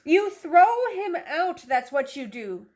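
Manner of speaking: ordinary delivery, nothing marked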